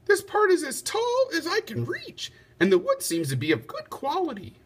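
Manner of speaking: high, lightly voiced lady; a noble lady judging the tree; lady's voice. A narrator's reading. Lady Di speaks.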